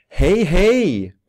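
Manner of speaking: loud, high cheerful greeting; glad hälsning. Shown as a demonstration. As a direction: greet gladly, pitch leaping up then falling. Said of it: "om du låter glad eller arg". (glad och ivrig, högt och ljust)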